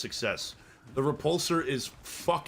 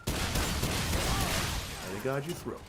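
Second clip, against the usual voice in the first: very slow and drawn out; 3 dB quieter, much slower than usual. Acted. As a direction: stretch every word slowly. Repeats the guiding line slowly.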